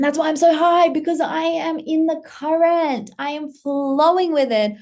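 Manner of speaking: high, loud, emphatic excitement; elated declaration. Real, not acted. Creator explaining why she is so high, elated. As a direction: proclaim it high with punched words.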